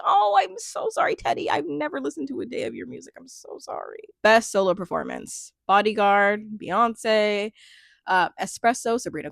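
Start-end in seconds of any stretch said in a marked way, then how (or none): 0.0-4.0 high, gushing apology fading to a whisper; over-the-top sorry, shrinking away at the end; mock apology. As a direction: plead playfully up high, then trail into breath. Apologising to an absent singer.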